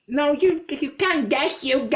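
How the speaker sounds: lightly voiced worried girlfriend; mimicking his girlfriend.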